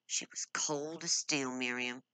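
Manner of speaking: bitter whisper. Acted. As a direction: hiss it under the breath. Paul's bitter aside about her.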